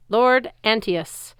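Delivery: loud formal address. Acted. Luke addressing the giant before the crowd, loud and formal. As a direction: announce him to the whole arena. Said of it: "loud enough for the crowd to hear".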